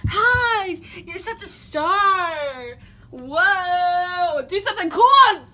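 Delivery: excited squeal. Real, not acted.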